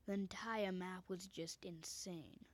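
normal talking voice

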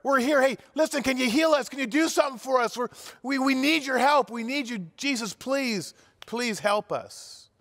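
high, pleading calls across a distance; calling out. Acted. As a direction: cry out to Jesus from far off, then fade to a plea. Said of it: "they start yelling".

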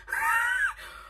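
sudden high laugh; high burst of laughter. Real, not acted. Laughs at being overwhelmed.

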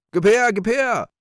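high, loud, grasping shout; greedy cry. Acted. Rich man grabbing for the sack, greedy cry. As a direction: demand it greedily at full voice. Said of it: "rief habgierig der reiche Mann".

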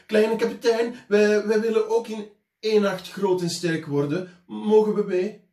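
put-on high, loud children's voices calling. A narrator's reading. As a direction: call up to the captain like eager kids. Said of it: "klommen op het hek van de boot en riepen". (kinderen roepen enthousiast, hoog en luid)

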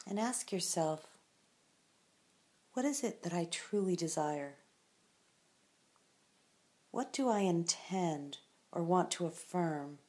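unhurried meditation voice with long silences; calm, spacious guiding of a resting listener; guided meditation. Acted. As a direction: guide the listener gently into stillness, leaving space after each phrase.

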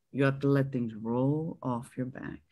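low, soft, measured mantra; self-soothing reminder. Retold or imitated. Actress calming her outrage with her teacher's advice, low and soft. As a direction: talk yourself down quietly.

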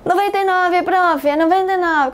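lightly voiced eager student; a hint of a pupil asking the teacher; imitating a student. Teacher plays a student asking about ninety-nine, lightly voiced. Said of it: "Como é que eu digo".

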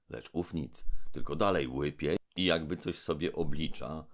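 ordinary delivery, nothing marked

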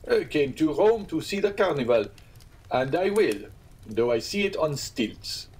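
lightly voiced eager Albert; a touch of stubborn enthusiasm; young man's protest.

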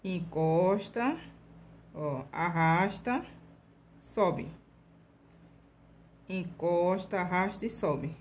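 each word stretched out slowly with pauses; walk the students through it step by step; slow stroke demo. Shown as a demonstration. Teacher slowly repeating the steps for beginners. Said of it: "repeating the stroke steps slowly".

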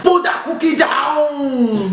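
slow, stretched action-hero command; Arnold Schwarzenegger. Acted. Mum's try at a film star, slow hammy shout. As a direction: draw out a mock Austrian shout. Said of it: "Arnold Schwarzenegger".

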